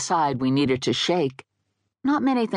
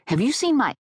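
much higher, affronted; indignant protest; 10 semitones higher than usual. Acted. Offended woman challenges dead guy, indignant. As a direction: launch an outraged defence, cut short.

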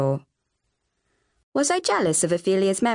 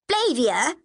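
high, outraged cry of a name; scandalised exclamation; 7 semitones higher than usual. Acted. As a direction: scold your little sister, appalled. Sister scandalised by rude retort.

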